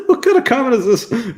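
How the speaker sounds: high, incredulous laughing question; shocked laugh. Real, not acted. Vlogger reacting to a comment, laughing in high disbelief.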